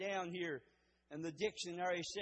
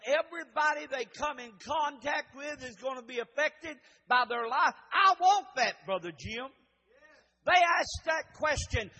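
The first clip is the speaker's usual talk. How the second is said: loud, high-pitched sermon delivery; impassioned pulpit shout; full-force preaching; 5 semitones higher, 7 dB louder than usual. Real, not acted. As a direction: preach it out hard, driving each phrase.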